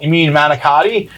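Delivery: ordinary talk, nothing marked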